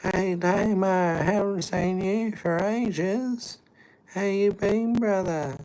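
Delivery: high, slow mock voice of an overfriendly stranger; imitating a stranger. Stranger mistaking him for Damo, high mock greeting. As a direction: put on a high drawn-out greeting voice. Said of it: "I had somebody go".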